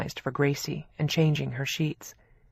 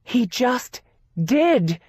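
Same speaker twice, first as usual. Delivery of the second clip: despairing; 7 semitones higher, 6 dB louder, much slower than usual. A narrator's reading. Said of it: "in a tone of despair".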